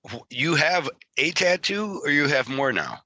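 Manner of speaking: usual speaking voice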